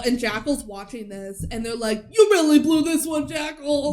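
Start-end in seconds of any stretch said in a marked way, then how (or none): 2.1-3.9 raised, higher-pitched taunt; mock jeering shout. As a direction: call it out like a mocking onlooker. Acting out onlookers' jeer at Jackal.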